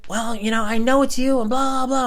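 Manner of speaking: very high, whiny neighbor voice; mocked arguing neighbor. Neighbor insisting he is the culprit, high mocking voice. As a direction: mimic her protesting way up high.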